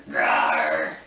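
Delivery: low, loud toy-dragon roar; playful monster growl. Pretend.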